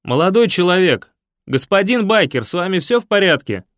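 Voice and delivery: loud worried call to a stranger. A narrator's reading. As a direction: shout out, worried and polite. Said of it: "крикнул".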